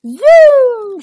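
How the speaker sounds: loud, high storybook zoom; big sound-effect word; excited whoosh. A narrator's reading. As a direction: launch the word upward like a take-off. Broomstick takes off in story.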